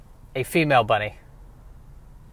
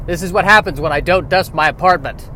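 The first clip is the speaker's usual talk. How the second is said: normal talking voice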